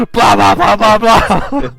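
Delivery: very loud, high rapid-fire bursts; mock gunfire. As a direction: shout out gunshot noises like an action-film shootout. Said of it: "it's like Pulp Fiction".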